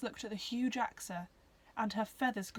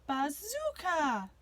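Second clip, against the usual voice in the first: bird cry; 6 semitones higher, 4 dB louder, much slower than usual. Acted. Phoenix crying its name.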